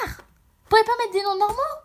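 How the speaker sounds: high, loud exasperated complaint; real exasperation bursting out; fed up. Real, not acted. Reader complains to game writers about hard names, exasperated. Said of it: "mais j'en ai marre".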